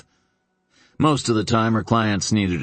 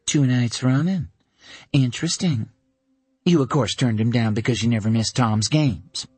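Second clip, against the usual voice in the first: dry, teasing friend, a little higher; lightly voiced Dana; 4 semitones higher than usual. A narrator's reading. Dana ribbing Caroline about her date, light teasing voice. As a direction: needle her gently.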